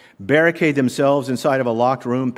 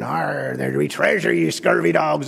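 pirate talk. Retold or imitated. Preacher mimics office pirate talk, mock pirate accent. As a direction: ham up a stage pirate growl. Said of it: "that's how pirates talk".

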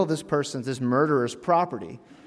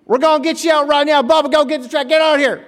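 high, loud country holler; hammed-up Southern rescuer shouting; Tennessee rescuer; 14 semitones higher, 11 dB louder than usual. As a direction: holler like an excited Tennessee local. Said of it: "you hear someone go".

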